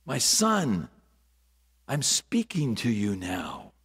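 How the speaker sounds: put-on soft, low and intimate, as God calling a child; hushed divine voice, gently addressing each listener; God's whispering voice. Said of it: "voice whispering".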